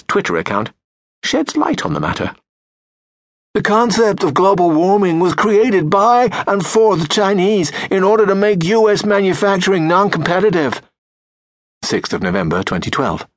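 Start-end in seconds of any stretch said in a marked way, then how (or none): 3.5-10.8 reading the tweet in a higher, louder put-on voice; mock Trump tweet. Narrator mocking a Trump tweet, higher and louder. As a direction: send up Trump's words with a pompous read.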